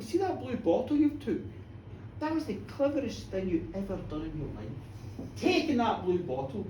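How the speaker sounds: low, soft, knowing little man; lightly voiced cunning leprechaun; sly wee fella. Wee fella speaking slyly.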